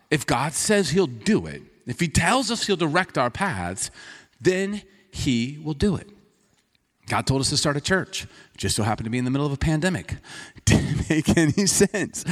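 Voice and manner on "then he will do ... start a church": usual speaking voice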